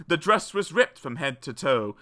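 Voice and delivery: ordinary delivery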